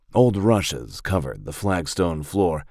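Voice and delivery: usual speaking voice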